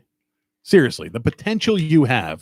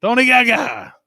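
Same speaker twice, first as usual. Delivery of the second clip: Italian-American accent; 9 semitones higher than usual. Shown as a demonstration. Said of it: "Pretend you're speaking Italian".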